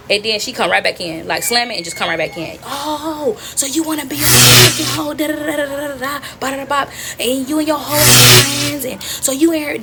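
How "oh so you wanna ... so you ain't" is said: higher-pitched imitation of an angry mother; acted scolding tirade; mad mama. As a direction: storm in ranting, bursts of loud emphasis. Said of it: "now she's even more mad".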